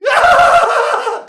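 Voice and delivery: very high, loud mock scream; long full-blast "ah" shriek; imitated group scream. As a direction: scream like a whole bunch of faces. Bunch of faces screaming, imitated.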